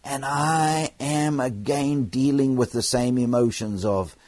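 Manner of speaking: ordinary delivery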